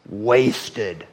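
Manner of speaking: "wasted" set apart and stressed; emphatic WASTED. Shown as a demonstration. Preacher showing which word he emphasized, stressed "wasted". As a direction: give "wasted" deliberate weight as a word alone. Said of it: "put emphasis on the word".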